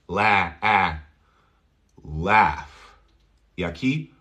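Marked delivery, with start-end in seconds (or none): 0.1-2.7 "Laugh" with vowel between A and E; blend of A and E. Shown as a demonstration. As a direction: say "La, A" with the cat vowel. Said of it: "una combinación entre una A y una E".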